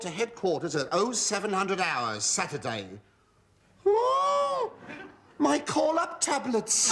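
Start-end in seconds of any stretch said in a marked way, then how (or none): 3.9-6.9 shrill dismay. Acted. Reacting to call-up papers.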